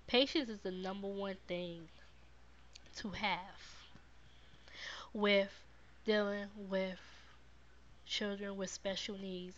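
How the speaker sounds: ordinary delivery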